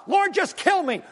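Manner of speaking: high, loud, exasperated plea; ticked-off Jonah. Retold or imitated. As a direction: beg God to end it, fuming. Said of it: "he is ticked off".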